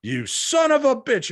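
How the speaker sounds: high, loud, drawn-out accusation; mock outraged shout. Man turning on his laughing friend, mock outraged shout.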